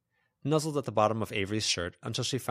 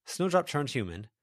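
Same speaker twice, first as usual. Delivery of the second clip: usual speaking voice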